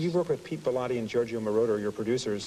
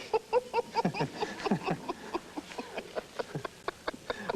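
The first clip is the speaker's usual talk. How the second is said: breathy laugh; 11 semitones higher, 5 dB quieter than usual. Real, not acted. Interviewer forgets names, cracks up.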